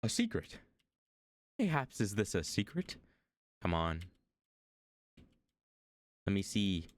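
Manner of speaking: ordinary talk, nothing marked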